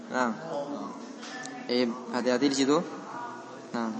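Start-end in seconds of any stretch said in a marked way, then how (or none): none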